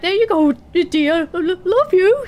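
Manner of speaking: high, loud, sugary sweet-talk; sheepish husband. Acted. Husband sheepishly handing his wife the gold. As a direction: coax her nervously while paying up. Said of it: "sheepishly, like".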